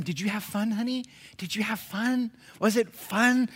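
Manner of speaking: high, syrupy mock-parent voice; mimicking parents. Parent asking honey about having fun, mimicked high. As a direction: gush it like a doting parent, mockingly. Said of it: "you hear parents say it all the time".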